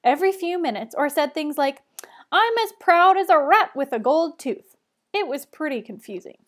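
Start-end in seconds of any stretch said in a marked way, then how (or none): 2.3-4.8 high, loud showman voice; funny way of talking. A narrator's reading. Lou boasting to the assembly, loud showy voice. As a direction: sell the silly saying with gusto. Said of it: "he talked in that funny way of his".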